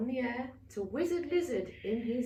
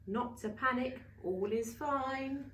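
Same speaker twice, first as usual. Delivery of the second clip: usual speaking voice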